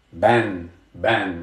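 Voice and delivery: "Ban" said as B plus A plus N, a low drawn-out teacher model; Arabic syllable demo. Shown as a demonstration. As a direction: say "ban" slowly for the class to copy. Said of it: "The letter ba sounds like B".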